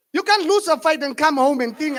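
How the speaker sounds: ordinary delivery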